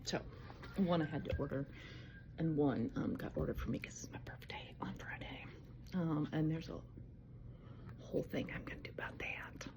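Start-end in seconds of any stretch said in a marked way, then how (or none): 3.0-5.5 a soft secretive whisper. Real, not acted. Telling viewers about a birthday charm, whispered. 8.0-9.7 whisper. Real, not acted. Teasing an upcoming video.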